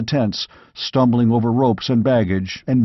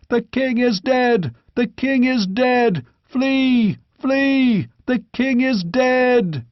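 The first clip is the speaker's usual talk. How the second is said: crowd panic; 12 semitones higher, slower than usual. Acted. Fleeing army shouts.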